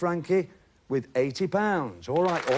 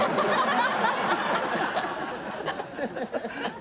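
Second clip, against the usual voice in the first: big laugh. Real, not acted. Host laughs at his own joke.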